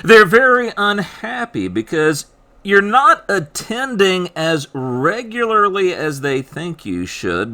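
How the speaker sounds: ordinary talk, nothing marked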